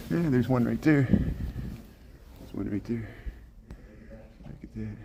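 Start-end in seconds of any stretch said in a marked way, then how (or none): 1.0-5.0 slow hushed whisper. Real, not acted. Filming a gorilla up close, slow whisper. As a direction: breathe it out slowly so the animal stays calm.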